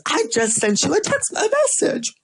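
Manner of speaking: friend voiced way up high, excited; high, bright mock of a friend. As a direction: squeal it like an excited buddy.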